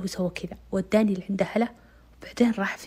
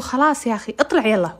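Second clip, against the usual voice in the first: annoyed push; 3 semitones higher, 6 dB louder than usual. Retold or imitated. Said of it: "إلا أمل تقول".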